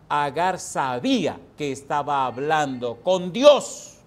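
full-force sermon delivery; fiery preaching. Acted. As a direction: proclaim it to the whole church. Preacher reads and expounds loudly.

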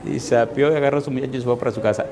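normal talking voice

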